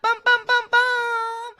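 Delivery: high, loud "bum bum bum" fanfare chant; mock drumroll. Host teasing the next cast member with a mock drumroll. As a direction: build suspense like a drumroll before a reveal.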